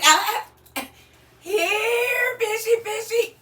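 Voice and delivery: high sing-song fishy call; calling the fish. Pretend. Host pretending to reel in viewers, high sing-song call. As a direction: coax the fish in, high and loud.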